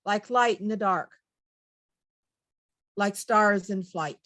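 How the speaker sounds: slow, measured recital with long pauses; poem reading. Acted. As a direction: read verse line by line, letting each image stand. Reads a poem aloud.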